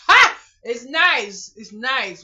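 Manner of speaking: loud high laugh into words; cracking up; laughing loudly. Real, not acted. Laughs at movie comparison.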